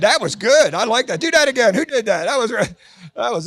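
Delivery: ordinary talk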